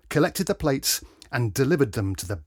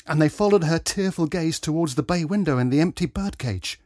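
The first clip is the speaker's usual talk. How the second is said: ordinary delivery, nothing marked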